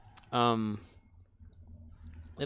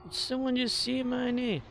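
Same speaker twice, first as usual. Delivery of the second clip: meerkat voice; 10 semitones higher than usual. Retold or imitated. Repeats meerkat line to himself.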